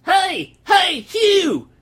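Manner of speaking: loud hillbilly holler from across the yard; eager shout at the farmhand; calling out. Acted. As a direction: yell it out so Hugh hears you. Calling out to Hugh from afar.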